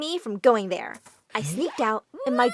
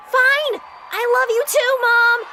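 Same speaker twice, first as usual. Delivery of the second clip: much higher, louder embarrassed yell; exasperated shout; 10 semitones higher, 6 dB louder, slower than usual. Acted. Kelly yelling back to her mom on the field, exasperated shout. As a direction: give in with a loud exasperated cry.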